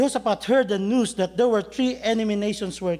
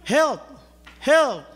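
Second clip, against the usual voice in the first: calling for help; 8 semitones higher, 5 dB louder than usual. Acted. Two help calls.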